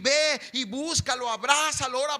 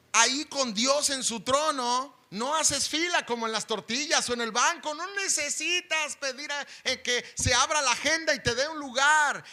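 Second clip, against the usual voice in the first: ordinary delivery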